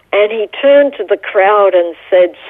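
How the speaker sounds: ordinary delivery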